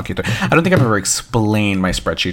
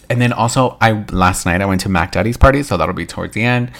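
ordinary talk

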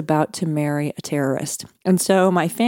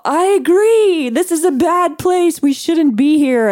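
high and loud, panic rising in the voice; about to cry, pitch jumping up in alarm; frightened outburst; 10 semitones higher, 4 dB louder than usual. Retold or imitated. In a dangerous city, telling her husband she's scared. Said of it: "I reacted as well".

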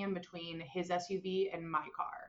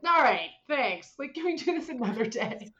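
loud, higher-pitched fed-up retort; exasperated; 5 semitones higher, 6 dB louder than usual. Retold or imitated. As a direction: brush off a nagging brother, loudly. Exasperated comeback to lecturing brother.

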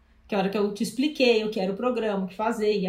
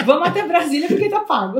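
laughing; 5 semitones higher, 6 dB louder than usual. Real, not acted. Joking about the prepaid trip.

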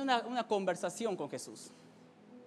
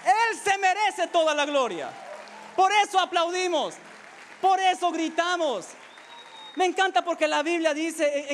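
raised, emphatic sermon delivery; impassioned preaching; 6 semitones higher, 5 dB louder than usual. Acted. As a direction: proclaim it to rouse the congregation. Preaches at full force.

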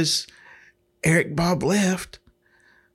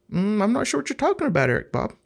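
mock innocence; 6 semitones higher, faster than usual. Feigning innocence to the manager.

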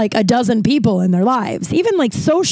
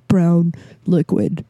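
low, slow deadpan; 4 semitones lower, slower than usual. Retold or imitated. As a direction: say it flat and low like a stubborn friend. Imitating friend's deadpan answer.

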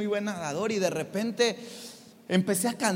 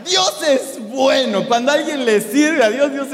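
laughing praise; 3 semitones higher, 8 dB louder than usual. Real, not acted.

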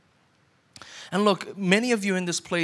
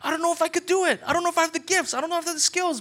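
unsure believer; 10 semitones higher than usual. Retold or imitated. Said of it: "your first question was like".

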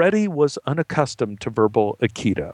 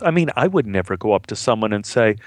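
plain reading voice

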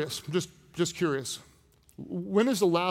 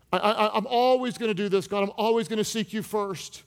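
higher-pitched eager promise; earnest vowing; 4 semitones higher than usual. Acted. Believer promising God to seek him first, earnest vowing. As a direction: vow it emphatically, pitched up. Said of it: "And right after you promise".

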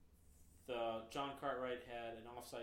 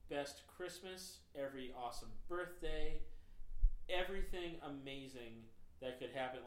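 rising, louder, slowing with delight; overjoyed rush; 3 semitones higher, 15 dB louder, slower than usual. Real, not acted. Telling friends of a boss's rare kindness, overjoyed. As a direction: pour out pure joy at the memory. Rapturous memory of being allowed.

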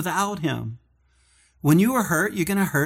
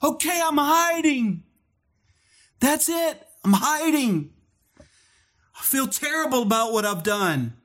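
guilty confession; 10 semitones higher than usual. Retold or imitated. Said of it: "he finally just said".

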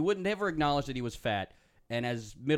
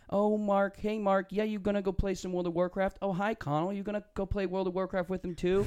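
high, singsong mock-friendly taunt; condescending big-brother sneer; mocking older brother; 9 semitones higher than usual. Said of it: "would always be like".

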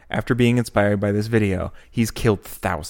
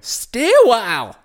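a voice he calls a southern dwarf; 11 semitones higher, 4 dB louder, slower than usual. Acted. Doing a game character voice.

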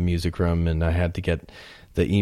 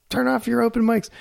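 retold yell. Everybody to the guy with an open mic, mock yell. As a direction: imitate everyone yelling, pitched way up. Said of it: "everybody yelled".

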